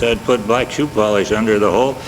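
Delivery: ordinary talk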